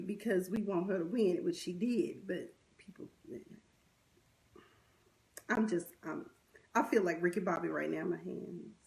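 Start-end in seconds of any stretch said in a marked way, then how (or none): none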